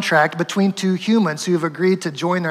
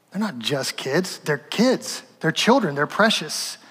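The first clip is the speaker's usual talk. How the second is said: tender; 3 semitones lower, 6 dB quieter, slower than usual. Acted. Jesus voiced, tender correction.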